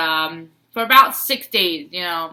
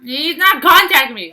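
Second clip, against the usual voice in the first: exasperated; 8 semitones higher, 9 dB louder than usual. Retold or imitated. Frustrated retelling, loud.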